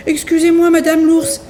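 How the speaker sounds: put-on high little-girl voice, sorry; a small girl excusing herself; Goldilocks apologising. A narrator's reading. Goldilocks apologises to mama bear, high and sorry. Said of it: "répond Boucle d'Or".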